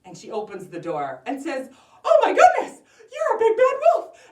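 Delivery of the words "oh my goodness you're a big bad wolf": high, loud shriek of alarm; startled grandmother. Acted. Grandmother finds a wolf at her door, startled shriek. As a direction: cry out in shocked high voice.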